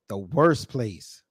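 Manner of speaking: slow, low repeat leaning on "worst"; emphatic repeat. Real, not acted. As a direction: stretch out "worst" to drive it home.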